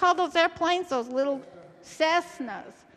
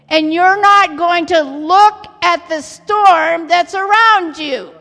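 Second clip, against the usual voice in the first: loud, slow, emphatic command; forceful warning; 10 dB louder, slower than usual. Retold or imitated. Airport controller orders frightened passengers to listen, forceful warning. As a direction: drive each phrase home loudly and deliberately.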